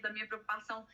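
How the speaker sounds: ordinary delivery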